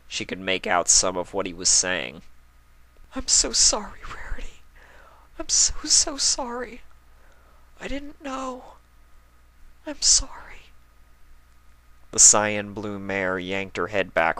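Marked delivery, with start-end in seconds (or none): 3.1-10.7 very high, tearful sobbing voice; crying apology. Acted. Spike alone in his room apologizing to Rarity, crying. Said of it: "Spike was crying".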